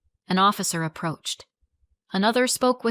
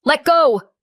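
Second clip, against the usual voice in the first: loud high shout; 8 semitones higher, 10 dB louder than usual. A narrator's reading. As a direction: yell it to break her grip. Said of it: "my mom shouted".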